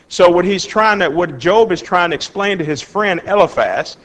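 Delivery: ordinary talk, nothing marked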